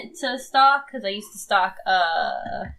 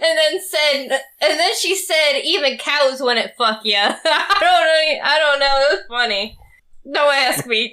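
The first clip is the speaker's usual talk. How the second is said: laughing; 5 semitones higher, 4 dB louder than usual. Real, not acted. Laughing hard at the story.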